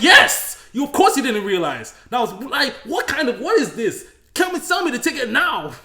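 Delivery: loud high scolding; loud high indignant demand at the inspector; mock indignant demand. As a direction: put him in his place, loud and high. Retelling angry demand to inspector.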